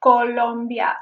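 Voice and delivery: Colombia with pure Spanish o's; Spanish-style Colombia; wrong Colombia. Shown as a demonstration. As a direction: show the incorrect Colombia with round o. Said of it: "It is not".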